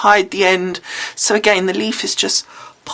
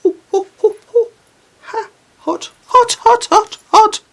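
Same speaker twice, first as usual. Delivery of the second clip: high pained yelps, much higher; burned by hot glue; 6 dB louder than usual. Real, not acted. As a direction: squeal in real pain from a burn. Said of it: "this is so hot".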